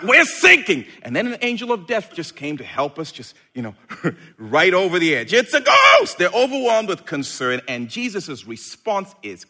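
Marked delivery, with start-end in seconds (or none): 0.0-0.9 mock panic. 5.3-6.2 loud, high scared shout; frightened shout. Acted. Disciples seeing Jesus on the water, loud high frightened shout. Disciples' cry voiced again.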